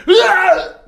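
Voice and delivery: high loud cartoon scream with a laugh; mock scream. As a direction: yelp as the wave hits. Villain hit by wave.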